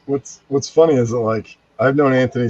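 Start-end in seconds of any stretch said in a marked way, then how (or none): none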